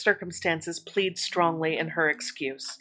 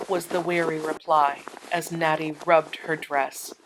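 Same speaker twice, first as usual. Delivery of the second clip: plain reading voice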